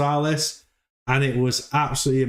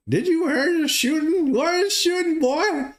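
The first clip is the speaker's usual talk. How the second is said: mock old Texan neighbor. Neighbor asking Butterstarch about the gunshots in a high cartoon voice. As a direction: squeak out a nosy old neighbor's question. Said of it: "the neighbor comes out and is like".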